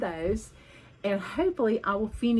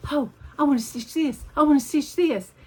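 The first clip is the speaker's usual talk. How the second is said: higher, louder mock eagerness; eager wanting; 6 semitones higher, 7 dB louder than usual. Mimicking her own craving for new projects, excited. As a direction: gush like a kid in a candy store. Eager stitcher imitating herself.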